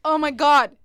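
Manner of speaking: loud shriek of shock; shrieking jackpot winner. Acted. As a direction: scream it like you just won. Mock shock at revelation.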